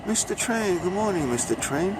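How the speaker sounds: cheery greeting. Real, not acted. Greeting a passing train cheerfully.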